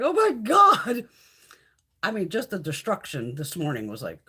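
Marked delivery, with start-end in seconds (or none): 0.0-1.0 drawn-out, louder, higher Oh my God; retold alarm. Streamer retelling her panic over the storm, loud and drawn out. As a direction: recreate the panic of watching the storm. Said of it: "freaking out about Miss Teresa".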